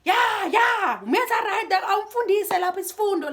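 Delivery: high, loud jeering shouts; cheering shout. Retold or imitated. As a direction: egg him on like a rowdy crowd. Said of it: "Dichomi tsa hae di re".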